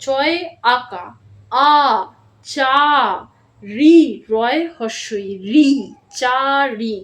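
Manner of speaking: each letter drawn out slowly, then blended; spelling out slowly. Shown as a demonstration. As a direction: spell it out for young pupils. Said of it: "আমি কিভাবে বলছি".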